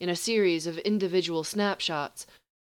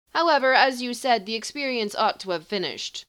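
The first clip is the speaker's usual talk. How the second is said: higher, louder lecturing sorcerer; haughty sorcerer; 6 semitones higher, 5 dB louder than usual. Acted. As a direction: correct him with lofty calm.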